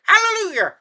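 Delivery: high falsetto Hallelujah; Madea voice. Shown as a demonstration. Showing how Madea talks, high falsetto Hallelujah. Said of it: "he kinda talked like this".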